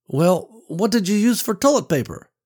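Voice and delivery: put-on high, cheeky child; teasing boy voice. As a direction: needle her like a giggling kid. Boy teasing his mother.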